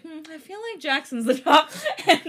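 half laughing as you admit the guess. Retold or imitated. Retold own thought, laughing.

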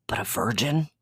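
incredulous distaste, understated; mild scandalized question; said like a dirty word. A narrator's reading. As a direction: treat the word as mildly dirty. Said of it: "He says it like it's a really dirty word".

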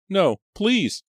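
high, loud desperate plea; a frightened girl begging please; pleading cry. A narrator's reading. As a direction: beg for your life. Della begs captor.

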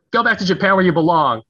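high, loud jeering shout; nasty playground taunt. Retold or imitated. Girl on the playground driving him away, loud high taunt. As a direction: spit it at him like a mean kid.

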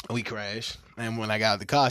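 ordinary delivery

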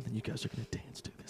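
fast, unvoiced mock whisper; hushed aside. Singer confiding about his song, quick whisper. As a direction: whisper it quickly as a sly secret.

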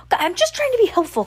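fast, high, wounded protest; hurt brother snapping back fast; defensive outburst. Acted. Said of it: "Klaus said".

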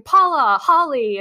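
high, loud called names, each falling; imitating crowd cheering. Crowd cheering runners Paula and Holly, a mock cheer. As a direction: call out like spectators cheering runners. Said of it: "people were cheering".

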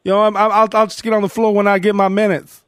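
Kirk Heinrich impression.

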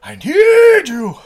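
slow, high, loud cry; hammed-up desperate plea; Blackula imploring. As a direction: wail it with drawn-out mock passion. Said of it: "he's like".

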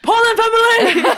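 high, loud laughing cheer; shout out. Real, not acted. Shouting out her Portland family, loud and laughing. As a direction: cheer it out to them. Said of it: "Shout out your Portland family".

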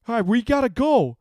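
loud, high warning shout; urgent warning. A narrator's reading. Man pulls the woman away, urgent warning. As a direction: warn them loudly to move. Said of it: "a man warned".

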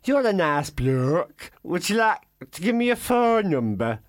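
bad celebrity impression, drawn out slowly; botched girl-band singer impression. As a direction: attempt a Liverpool pop star, badly. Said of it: "Mel C might say".

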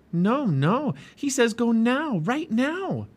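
put-on high-pitched, insistent girl; urgent Annie. A narrator's reading. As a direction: press the order urgently in a high voice. Annie urgently relays owl's order.

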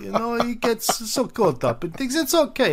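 smooth it over, all charm; Stalin. Retold or imitated. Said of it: "this is Stalin's attempt to make a good impression".

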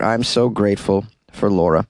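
ordinary talk

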